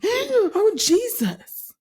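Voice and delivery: "Oh, Jesus." with a gasp first, then a big high cry on "Oh"; hammed-up startled passenger, loud and high; exaggerated panicked exclamation. Wife mocking husband's over-the-top fright in the car. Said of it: "He didn't do it like that, but he was extra".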